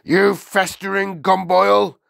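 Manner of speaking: high, loud, outraged abuse; furious headmistress yell; shouted insult. A narrator's reading. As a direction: hurl it at the pupil. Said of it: "shouted the Trunchbull".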